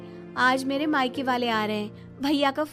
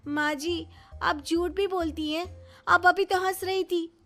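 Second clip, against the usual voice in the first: higher-pitched, indignant reproach; indignant accusation; 7 semitones higher than usual. Acted. Daughter-in-law catches mother-in-law in a lie, indignant.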